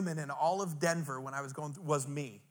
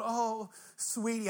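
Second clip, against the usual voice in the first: slow, higher, doting "Oh, sweetie"; doting drugged gush; 6 semitones higher, much slower than usual. Retold or imitated. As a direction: coo it like you adore everyone. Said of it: "I'm stopping them going".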